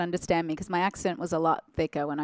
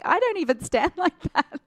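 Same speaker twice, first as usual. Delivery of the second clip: laughing protest; 11 semitones higher, 4 dB louder than usual. Retold or imitated. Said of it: "I'm like".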